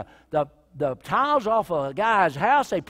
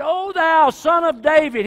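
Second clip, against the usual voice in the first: loud desperate cry to Jesus; 9 semitones higher, 11 dB louder than usual. Acted. Blind man crying out to Jesus by the road, loud. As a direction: call out with all your faith.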